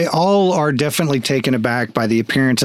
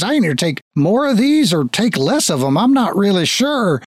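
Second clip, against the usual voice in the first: higher voice, doubting his own sanity; baffled papa; 6 semitones higher than usual. Acted. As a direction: muse in bewilderment, slowing and rising at the end. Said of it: "He pulls out a pill packet".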